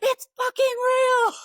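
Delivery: high, loud yelled confirmation; excited shout. Retold or imitated. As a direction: yell it like the best news ever.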